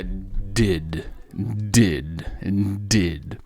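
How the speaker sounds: DID with two hard Ds; slow, low DID, no J and no final vowel; teacher modelling DID; English did. Shown as a demonstration. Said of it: "vou colocar essa palavra".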